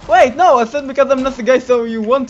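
alarmed protest. Real, not acted. Protesting being sacrificed.

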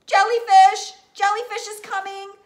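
high, loud panicked shriek; kid's terrified yell at the sea; jellyfish alarm. A narrator's reading. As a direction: scream the name like a monster is coming. Dramatic reveal of jellyfish.

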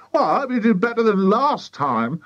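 high, fussing character voice; doubtful wife. A narrator's reading. Missus Cranky follows them out, doubtful high voice. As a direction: pitch it up, sceptical and scolding. Sceptical wife answers boasting husband.

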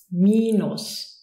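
minus as "MEE-noos", first vowel held; long I. Shown as a demonstration. Teacher showing learners long I, minus with I held. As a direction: stretch the I of minus as EE. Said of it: "Long version".

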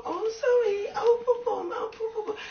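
mock pity. Mocking the fans who send only pity, high cooing mock sympathy. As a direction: coo it like hollow pity. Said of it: "But I don't feed on".

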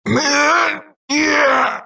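drawn-out high game character yell; high exclamation; high-pitched cry. Acted. Series of game character cries.

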